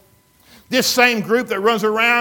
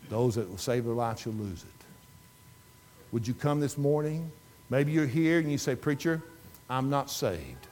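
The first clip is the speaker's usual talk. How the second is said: soft, low, pleading invitation; gentle altar call; 6 semitones lower, 6 dB quieter than usual. Acted. Preacher inviting listeners to come forward, soft and pleading. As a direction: draw them forward quietly.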